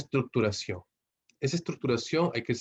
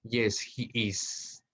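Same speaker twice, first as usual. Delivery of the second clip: very slow, careful short answers; teacher demonstrating full short answers; slow model answer; much slower than usual. Shown as a demonstration. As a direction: spell the answer out slowly for students. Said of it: "Fíjense la diferencia".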